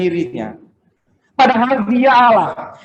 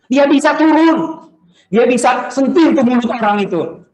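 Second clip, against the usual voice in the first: emphatic preaching; 4 semitones higher than usual. Real, not acted. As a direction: proclaim it forcefully from the pulpit. Pengkhotbah menegaskan kuasa Yesus. (berkhotbah lantang penuh penekanan)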